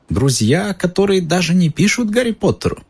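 high, sly little elf. Acted. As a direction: ask it slyly. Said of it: "лукаво спросил Добби".

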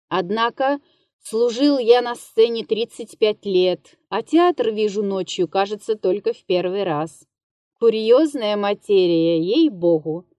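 ordinary delivery, nothing marked

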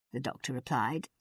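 ordinary delivery, nothing marked

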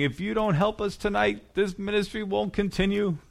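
mock begging. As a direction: beg like a fundraiser, in mild mockery. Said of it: "They're crying and they're begging".

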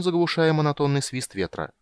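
ordinary delivery

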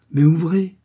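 a woman sure of her power, pressing; commanding. A narrator's reading. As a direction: order him to open, confident. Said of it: "avec la conscience de sa puissance".

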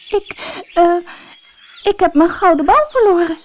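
high, stammering princess voice; a scared little princess getting her words out. A narrator's reading. Princess tells the frog about her lost ball, high and stammering. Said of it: "stamelde ze".

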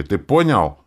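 loud, stern check. A narrator's reading. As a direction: press for understanding, strictly. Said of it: "сменил задумчивый взгляд на строгий". (строго, с нажимом)

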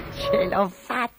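vocina acuta e lenta; gattino soddisfatto fra sé. A narrator's reading. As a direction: esulta sottovoce, da micino. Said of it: "Codabella sorrise fra sé". (piccola esultanza compiaciuta)

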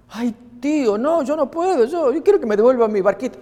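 put-on high child's voice begging; a boy whining to his uncle; pleading child. Nephew refusing revenge to uncle, high and pleading.

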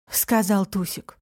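ordinary delivery, nothing marked

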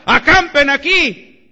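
loud, slow drawn-out order; shouted command. Retold or imitated. Preacher voicing a leader ordering camp, slow shout. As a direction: call out the order to make camp.

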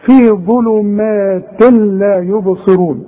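slow, "ظلمات لا" with the nun merged into the lam; every letter its due. Shown as a demonstration. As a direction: stretch "في ظلمات لا يبصرون" letter by letter. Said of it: "ادي كل حرف حقه". (تلاوة متمهلة تعطي "ظلمات لا يبصرون" حقها)

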